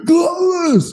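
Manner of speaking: high, loud gasped-out answer; gasp, then squeeze the word out as if dying; mock out of breath. Runner answering a stranger, hammed-up breathless cry. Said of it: "'Cause I can't breathe 'cause I'm dying".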